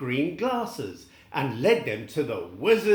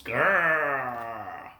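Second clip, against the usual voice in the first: growl; 3 semitones lower than usual. Acted. Tin Man fighting wolves.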